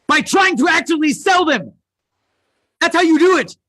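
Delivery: loud, high-pitched rallying cry; emphatic shout. Real, not acted. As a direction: shout the answer home to the audience. Shouts the answer to audience.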